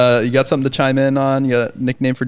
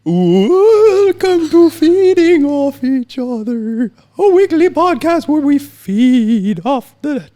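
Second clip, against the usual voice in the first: high, very slow ghostly greeting; 14 semitones higher, 5 dB louder, much slower than usual. Acted. Host opens the podcast in a high, slow ghost voice. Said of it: "another kind of a spooky".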